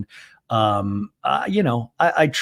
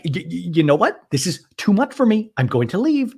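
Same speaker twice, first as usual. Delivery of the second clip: high, stammering mock fluster; imitating a flustered walk-out; Shannon impression; 6 semitones higher than usual. Host replays housewife threatening to leave, high flustered imitation. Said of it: "And Shannon's like".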